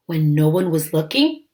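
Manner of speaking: ordinary delivery, nothing marked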